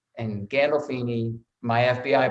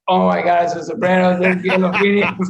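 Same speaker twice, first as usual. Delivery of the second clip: higher, louder starstruck gasp; 6 semitones higher, 9 dB louder than usual. Retold or imitated. Actor awed to sit beside Gandolfini on set, louder and higher. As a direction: blurt it out, awed and rattled. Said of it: "I was just like".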